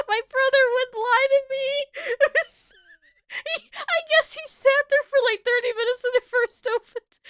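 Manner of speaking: high teary laughing speech; talking through tears of laughter; crying with laughter. Real, not acted. Crying with laughter while retelling the drive-thru story. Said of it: "I'm sorry, I'm crying".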